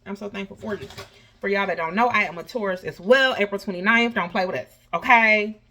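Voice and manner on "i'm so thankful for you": usual speaking voice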